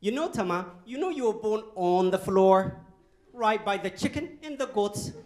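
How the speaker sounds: lightly voicing mom telling the birth story; mom's voice. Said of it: "she usually begins".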